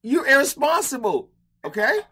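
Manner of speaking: retold scolding of a careless pet owner; loud blunt call-out. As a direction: tell them straight to their face, loud. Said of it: "I'm like".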